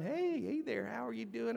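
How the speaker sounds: put-on high-pitched cooing to a baby; playful greeting in a high little voice; baby talk. Playing with his baby.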